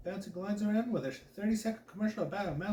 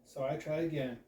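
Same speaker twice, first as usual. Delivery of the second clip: ordinary delivery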